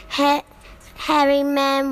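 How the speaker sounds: ordinary delivery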